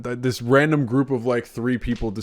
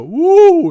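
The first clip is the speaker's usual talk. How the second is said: loud, high ghostly whoop; big drawn-out scare noise, hammed-up; mock spooky "woo"; 14 dB louder than usual. Imitating the polite monster scaring you, mock spooky. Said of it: "It just wants to go".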